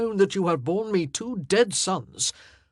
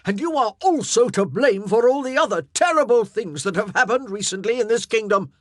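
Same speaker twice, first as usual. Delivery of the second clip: loud, higher accusing rant; wrathful; 6 semitones higher, 9 dB louder than usual. Acted. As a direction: pile every blame on her. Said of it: "his wrath".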